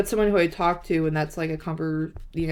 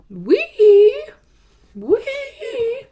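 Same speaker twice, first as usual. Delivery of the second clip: shrill squeal, far higher and louder; dying-pig squeal; 9 dB louder than usual. Real, not acted. Texting her crush, she squeals a high, piggy wee. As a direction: squeal like a pig in sheer delight. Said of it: "why do I sound like a dying pig?".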